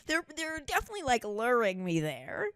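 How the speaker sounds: ordinary talk, nothing marked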